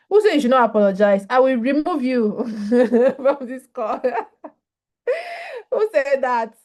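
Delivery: loud, fast playful threat, laughing; mock outrage with laughter. Real, not acted. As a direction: tease the commenter with pretend anger. Jokingly threatening a chat commenter.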